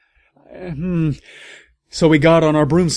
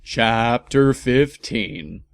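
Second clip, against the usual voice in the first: ordinary talk, nothing marked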